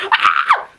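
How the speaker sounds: short loud excited yelp; a quick happy cry; excited shout. Real, not acted. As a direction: let out a burst of glee. Excited squeal about event.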